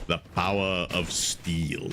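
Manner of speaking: a low, slow booming movie-villain line; deep impression. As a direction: growl it out slowly like a film villain. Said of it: "stop with the impressions".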